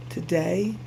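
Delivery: usual speaking voice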